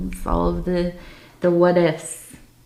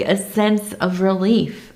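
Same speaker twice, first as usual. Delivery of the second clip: ordinary talk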